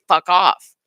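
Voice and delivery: loud, forceful brush-off; punchy, dismissive outburst; blunt retort. Acted. Acted retort to a villain.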